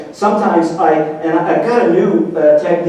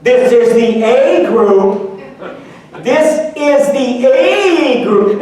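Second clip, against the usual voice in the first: slow announcing; 5 semitones higher, 3 dB louder, much slower than usual. Retold or imitated. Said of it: "she says it three times".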